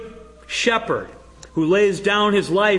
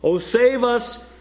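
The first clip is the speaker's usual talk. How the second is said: higher, slow pleading cry; 7 semitones higher, 3 dB louder than usual. Retold or imitated. Preacher voicing the crowd's plea to Jesus, slow raised cry. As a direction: draw out the plea as a crowd would. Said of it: "he cried, they cried".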